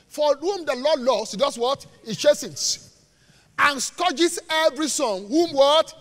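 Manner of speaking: loud, slow, declaimed verse; emphatic scripture reading. Real, not acted. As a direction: read it out slowly, prompting the congregation. Reads next verse to congregation.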